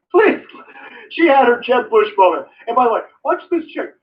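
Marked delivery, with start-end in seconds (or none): 0.1-0.7 higher, louder pleading please; mock plea. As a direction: beg the crowd like a flop candidate. Quoting Jeb Bush.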